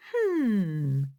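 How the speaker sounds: a loud, drawn-out thinking hum; thoughtful hmm. Acted. Dog pondering his next book, a loud thoughtful hmm. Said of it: "thought Dog".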